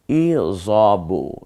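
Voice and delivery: ই জবু, very slow, with a short জ; careful Arabic syllables. Shown as a demonstration. Teacher drills ই জবু slowly for the class. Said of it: "দেখছেন আমি কিন্তু জ বলছি".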